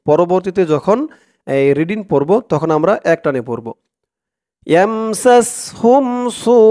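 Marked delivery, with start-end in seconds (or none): 4.7-6.6 سوء drawn out long and raised; slow recitation. Shown as a demonstration. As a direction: stretch سوء slowly with a lifted long vowel. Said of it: "teacher demonstrates recitation".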